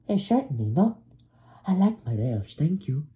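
high, loud, affronted engine; Percy. A narrator's reading. Percy rebuffs the boastful helicopter, high and affronted. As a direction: refuse indignantly in a high character voice.

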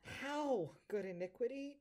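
high, loud aghast question; dismayed disbelief. Acted. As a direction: recoil at the sentence. Devil aghast at the news.

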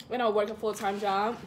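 speaking with food in mouth; words slightly muffled by chewing; talking while chewing. Real, not acted. Seen 3: Puts food in mouth at -2s, jaw keeps working while talking.

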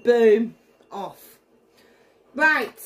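usual speaking voice

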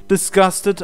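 ordinary delivery, nothing marked

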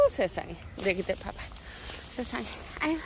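normal talking voice

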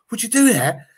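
high, loud mock amazement; thrilled disbelief. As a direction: imitate a fan delighted by the favour. Imitating officer thrilled by phone offer.

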